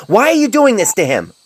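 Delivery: enraged shouting doctor; furious shouting. Acted. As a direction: shout at your boss in outrage. Enraged confrontation at superior.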